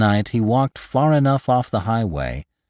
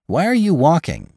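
high-pitched questioning; inquisitive driver; 10 semitones higher, 4 dB louder than usual. A narrator's reading. Driver asking Lantry why he walks, inquisitive. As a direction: lift the voice high to quiz him.

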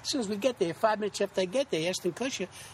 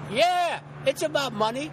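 higher, louder, slow yelled movie line; kids yelling it; 8 semitones higher, 4 dB louder, slower than usual. Retold or imitated. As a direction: call it out like kids spotting him at a golf event. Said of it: "I hear these kids yell out".

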